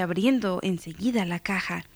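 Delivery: usual speaking voice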